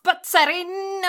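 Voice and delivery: high, drawn-out "serene"; accidental high flourish on "serene"; odd emphasis. Real, not acted. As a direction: lift "serene" up oddly and stretch it. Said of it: "why I said it like that".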